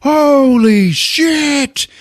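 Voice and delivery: very high, drawn-out "holy shit"; mock horrified cry, stretched long; shocked exclamation. Everybody seeing the heart, stretched shocked cry.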